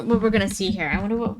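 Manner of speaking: ordinary talk, nothing marked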